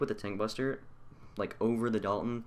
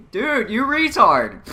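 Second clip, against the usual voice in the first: much louder and higher, slow furious yell; furious shout; 17 dB louder, much slower than usual. Real, not acted. Gamer yelling at a player, a furious shout. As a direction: scream it at the teammate.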